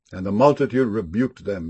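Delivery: ordinary talk, nothing marked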